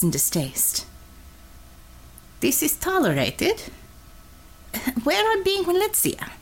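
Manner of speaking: a lightly voiced Russian woman, blunt and cocky; a light Russian accent, teasing the nervous driver; Russian commissar voice. Russian commissar teasing her driver, in a light Russian accent. Red Savior sees the drug dealers.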